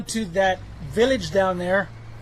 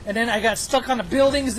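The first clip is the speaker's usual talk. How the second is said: ordinary delivery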